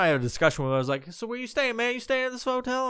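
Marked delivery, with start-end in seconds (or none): 1.1-2.9 casual teasing question. Retold or imitated. Replays his teasing hotel question.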